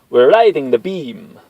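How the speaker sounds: high, loud, slow gleeful tech; excited tech voice. Acted. Tech announcing success to the team, high excited voice. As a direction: ride the moment, thrilled.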